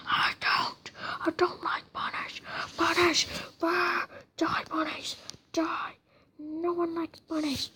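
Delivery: mock angry whisper-shout. Mock hatred of bunnies.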